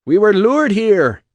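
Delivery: high, loud Irish brogue rally shout; bellowing Irish orator. Acted. Atlas rouses the crowd with a loud Irish brogue. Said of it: "Atlas bellowed".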